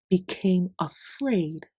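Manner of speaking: ordinary delivery, nothing marked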